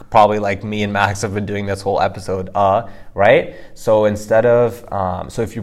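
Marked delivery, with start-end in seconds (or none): 2.5-2.9 "uh" short and neutral; the "uh" of a stutter; hesitation uh. Shown as a demonstration. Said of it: "if you're stuttering".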